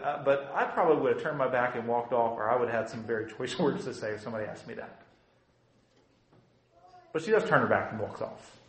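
normal talking voice

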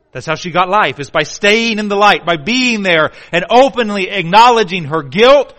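loud, high preaching cry; full-force preaching. Acted. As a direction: call it out hard.

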